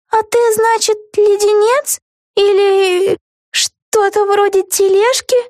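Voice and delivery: high, slow nervous question. A narrator's reading. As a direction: ask warily, uneasy. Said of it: "нервно спросила Вега".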